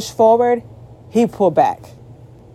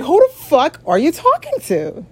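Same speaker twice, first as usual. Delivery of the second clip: high, slow, drawn-out disbelief; incredulous reaction; 9 semitones higher than usual. Acted. Woman reacting to what she's reading on her phone, high and incredulous. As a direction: stretch it out high, as if baffled. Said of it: "I'm just looking like".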